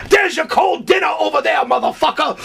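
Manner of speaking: high-pitched, irate yell; furious shouting. Retold or imitated. Host pointing out the ruined dinner, furious shouting. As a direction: scold the latecomer in a high, angry shout. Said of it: "he's fucking furious".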